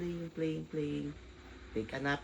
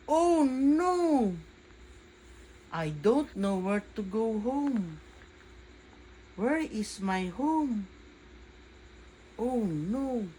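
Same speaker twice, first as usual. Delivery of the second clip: higher, louder, slow worried cry; lost little boy panicking, higher and louder; panicked child; 6 semitones higher, 6 dB louder, slower than usual. Acted. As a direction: sound lost and scared.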